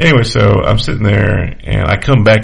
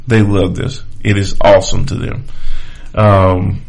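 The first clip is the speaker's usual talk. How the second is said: ordinary talk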